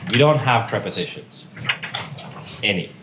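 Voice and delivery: ordinary delivery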